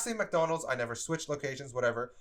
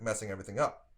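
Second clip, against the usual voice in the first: plain reading voice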